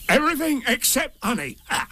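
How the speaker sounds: very high, indignant tiger; Tigger. A narrator's reading. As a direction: protest in a high creature voice. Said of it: "said Tigger".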